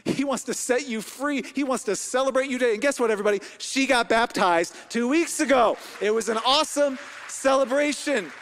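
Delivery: loud, high-pitched, emphatic preaching; full-force preaching. Real, not acted. Preacher telling the congregation Jesus went to the cross for them, full-force preaching. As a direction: proclaim it to the crowd with stretched key words.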